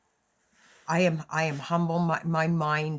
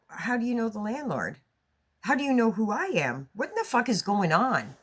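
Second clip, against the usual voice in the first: alarmed; 5 semitones higher than usual. Retold or imitated. Confronting cops, rising alarm.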